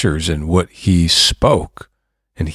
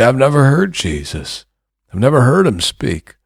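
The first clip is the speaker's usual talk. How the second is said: higher-pitched voice of an objector; doubting listener; 7 semitones higher than usual. Acted. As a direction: protest as a listener who doubts.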